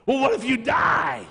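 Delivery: high, loud back-and-forth shouting; playful shouting match with himself; shouted argument. Acted. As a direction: yell both sides of the argument. Acted shouted argument with doubter.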